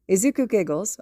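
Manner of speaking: ordinary delivery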